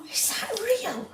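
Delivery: high, soft squeak of disbelief; real hushed, high-pitched amazement; amazed. Real, not acted. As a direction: ask it softly, voice right up high.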